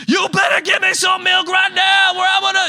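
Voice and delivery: put-on high, loud shouting baby voice; demanding baby. Pastor voices his son's angry demand for milk, high shouted. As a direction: scream the demand like a furious infant. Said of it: "It's like".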